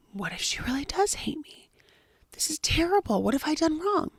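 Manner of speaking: high, softened acted-out fretting; worried stepmother. Stepmother doubts herself after the child's outburst, high and worried. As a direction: fret anxiously, starting hushed. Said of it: "feeling like".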